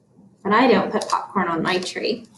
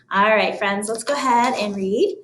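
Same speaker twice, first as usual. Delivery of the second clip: ordinary talk, nothing marked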